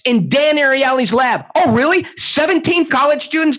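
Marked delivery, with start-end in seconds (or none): 1.5-3.6 high, mocking "oh, really?"; sarcastic disbelief. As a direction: scoff at the study result with a sharp rise. Mocking a lab study claim.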